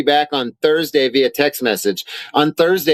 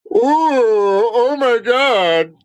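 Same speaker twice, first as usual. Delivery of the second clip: higher, very slow mock swoon over the heat; mock suffering; 8 semitones higher, much slower than usual. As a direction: drag out a sarcastic oh my God. Mocking statement's heat claim.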